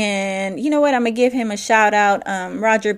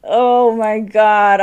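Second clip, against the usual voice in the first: exasperated; 4 dB louder, much slower than usual. Retold or imitated. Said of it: "And I was like".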